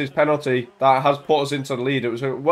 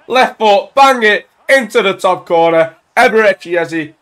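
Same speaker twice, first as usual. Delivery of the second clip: loud, high commentary burst; excited goal call; 6 semitones higher, 8 dB louder than usual. Real, not acted. Gamer calls Eze's finish, excited shout. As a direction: belt out the goal as it happens. Calling his goal as it goes in.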